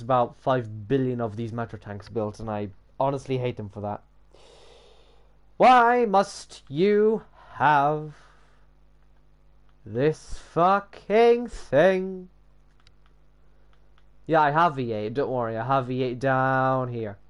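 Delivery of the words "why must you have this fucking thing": high, loud, word-by-word wail; exasperated. Real, not acted. Pleading with the model about an awkward part, exasperated. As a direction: stretch every word out in exasperation.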